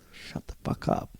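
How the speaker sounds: barely audible, voiceless hushing; secretive whisper. Retold or imitated. Teller secretly signalling the girl to be silent, acted whisper. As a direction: mouth it in a tiny urgent whisper.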